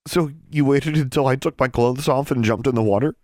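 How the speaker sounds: accusing. Acted. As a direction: throw the accusation at him.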